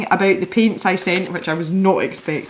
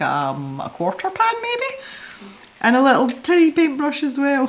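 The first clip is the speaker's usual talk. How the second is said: ordinary talk, nothing marked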